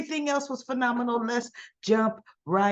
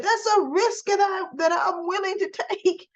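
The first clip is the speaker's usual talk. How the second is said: mock defiant shout; 9 semitones higher than usual. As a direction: throw it out like a reckless villain, half joking. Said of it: "Obert said".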